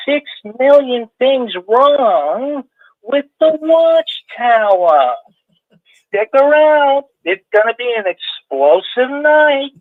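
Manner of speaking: high, slow, drawn-out showman announcer voice; Rick Barron impersonation. Pretend. Caller imitates host opening his show, high and slow. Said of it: "a Rick Barron impersonation".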